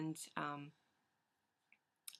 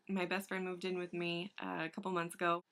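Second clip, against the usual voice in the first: normal talking voice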